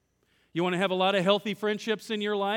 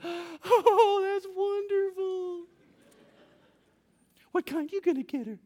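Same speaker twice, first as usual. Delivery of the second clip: put-on high, slow, gushing girl's voice; happiest high school girl; 12 semitones higher, slower than usual. Florist girl gushes at Matt's flower order, much higher and slow. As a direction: squeal it like a delighted teenage clerk. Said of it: "She goes".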